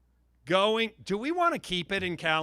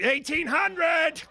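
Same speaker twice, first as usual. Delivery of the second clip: shout; 7 semitones higher, slower than usual. Real, not acted. Auctioneer shouting a bid.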